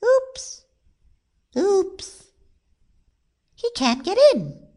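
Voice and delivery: high little squeaky voice; squeaky onlooker. A narrator's reading. Small onlooker watching the stuck rabbit, high squeak.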